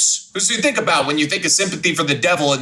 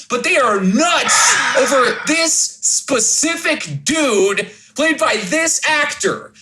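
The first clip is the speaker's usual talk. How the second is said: excited rant; 6 semitones higher, slower than usual. Real, not acted. Ranting about Tumblr fans.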